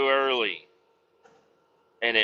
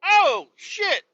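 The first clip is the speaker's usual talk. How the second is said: high, loud yelping curses; squealing curse when oil splatters; pained yelp; 6 dB louder, much slower than usual. Retold or imitated. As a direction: yelp as hot oil hits bare skin. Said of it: "I'm like".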